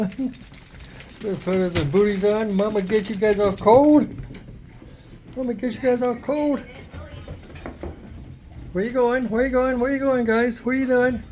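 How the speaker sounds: high cooing pet voice. Real, not acted. Owner cooing at puppies in their sweaters, high baby talk.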